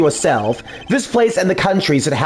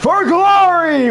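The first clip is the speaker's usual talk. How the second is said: higher, loud retold battle cry; 10 semitones higher, 3 dB louder, slower than usual. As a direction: shout it like charging a boss. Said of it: "I said".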